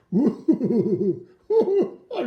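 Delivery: high howl breaking into laughs; wolf howl. Acted. Wolf mask shown to kids.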